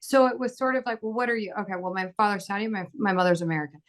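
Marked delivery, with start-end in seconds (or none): none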